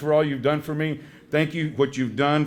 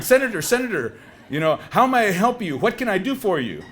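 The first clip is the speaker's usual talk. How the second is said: eager and fawning; 6 semitones higher, 4 dB louder than usual. Retold or imitated. Frank answering senator's call.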